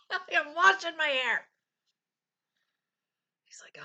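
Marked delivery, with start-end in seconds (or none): 0.1-1.4 calling out. Retold or imitated. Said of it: "answering husband from shower".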